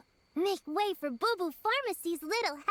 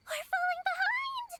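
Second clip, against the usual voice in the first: a much higher, urgent cry; urgent call; 12 semitones higher than usual. Acted. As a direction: hurry your friend along, pitched way up. Urgent during the race.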